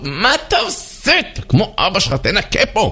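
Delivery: put-on high-pitched yelling at a child; hammed-up shrieky scolding; scolding mother. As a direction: berate your kid in a high, irritated voice. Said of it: "נותן עליו צעקות".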